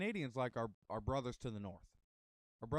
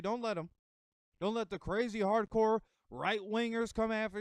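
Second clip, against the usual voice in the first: louder and higher, hammering each line like a sermon; rousing preaching; 7 semitones higher, 5 dB louder than usual. Acted. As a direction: fire up the crowd from the pulpit. Rallying the audience, preacher style.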